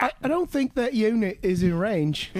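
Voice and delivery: high, laughing mock-British objection; British accent. British player disputing a unit's range, British accent. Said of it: "I've played some British guys".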